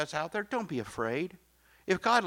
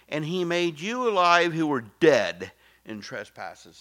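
louder and slow; emphatic scripture reading; 9 dB louder than usual. Acted. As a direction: proclaim the verse.

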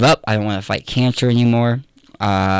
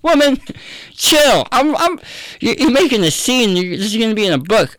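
much higher, hammed-up exasperated voice; exasperated Jesus; 11 semitones higher than usual. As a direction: play Jesus brushing her off, comically impatient. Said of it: "He could have been like".

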